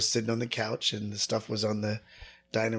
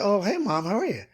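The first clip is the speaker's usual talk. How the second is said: bright, much higher cheerful greeting; cheery; 9 semitones higher, 3 dB louder than usual. Retold or imitated. Son greeting his mom on the phone, forced cheer, much higher. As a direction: put on a sunny cheerful voice. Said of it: "Oh, cheering".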